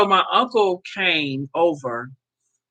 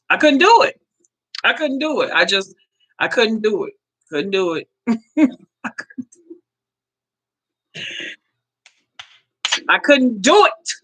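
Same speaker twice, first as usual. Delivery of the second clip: voice breaking; 6 semitones higher than usual. Real, not acted. Grieving over son's coffin.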